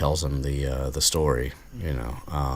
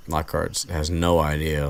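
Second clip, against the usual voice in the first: ordinary delivery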